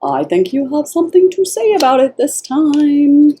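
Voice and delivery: high, drawn-up singsong tease; teasing singsong. Real, not acted. Reader egging on the client to speak up, teasing singsong. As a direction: lift it high and hold the notes, knowingly.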